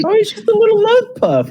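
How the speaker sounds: put-on high squeaky girl voice; girly voice. Explaining the love puff, high squeaky girl voice. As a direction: explain it away sweetly, high. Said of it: "And she's like".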